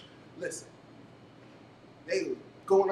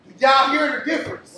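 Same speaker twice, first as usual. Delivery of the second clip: loud, higher question to the room; crowd call; 8 semitones higher, 13 dB louder than usual. Real, not acted. Host pressing the audience to answer, loud call. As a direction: get the crowd answering back.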